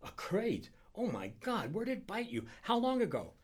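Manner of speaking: high, startled outburst of questions; alarmed questioning. A narrator's reading. Friend learns of the crate and panics, alarmed questioning. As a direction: jump in with shocked urgency.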